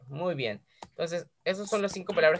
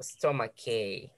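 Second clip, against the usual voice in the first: slow model word; 7 semitones lower, 7 dB quieter, much slower than usual. Shown as a demonstration. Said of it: "la pronunciación de cada una de ellas".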